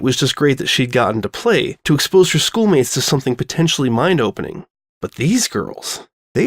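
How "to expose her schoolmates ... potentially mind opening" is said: plain reading voice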